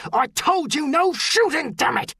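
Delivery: high, angry shouted reprimand; furious officer's bellow. A narrator's reading. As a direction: bellow at a disobedient trooper. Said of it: "Elaine Deccan bellowed".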